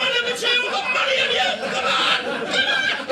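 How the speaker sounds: high excited shouting like a punter; betting punter screaming at a horse race; mock race cheering. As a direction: yell them home from the stands. Cheering racing penises.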